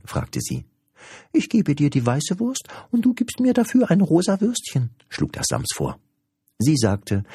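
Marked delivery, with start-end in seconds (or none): 1.3-4.9 very high-pitched creature voice; high, complaining little creature; cheeky little creature. Acted. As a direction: squeak it like an impish little creature.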